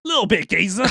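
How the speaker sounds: mock cockney barman accent; cockney geezer. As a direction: play a chirpy cockney geezer. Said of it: "was fucking like a cockney".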